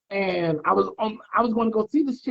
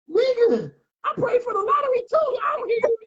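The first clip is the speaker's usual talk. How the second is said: much higher-pitched mock complaint; mock indignant protest; 13 semitones higher than usual. Man retelling his protest to God, mock indignant. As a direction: protest in a high, put-upon squeal.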